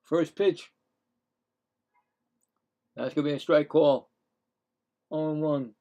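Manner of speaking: announcer voice for the at-bat; play-by-play announcer. Acted. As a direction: call each pitch like the broadcast booth. Calling batters and pitches.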